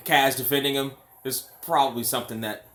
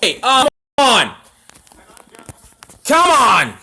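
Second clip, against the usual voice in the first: frustrated shout; 9 semitones higher, 12 dB louder than usual. Real, not acted. Frustrated at the screen.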